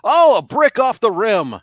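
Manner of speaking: high, loud play-by-play peak; radio sports announcer. Shown as a demonstration. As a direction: call the missed shot with big drama, stretching the last words. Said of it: "go straight to my radio stuff".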